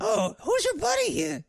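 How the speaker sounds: very high-pitched character voice; diner waitress. Acted. Waitress asks the player about his buddy, high-pitched waitress voice.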